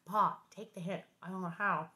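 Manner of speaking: usual speaking voice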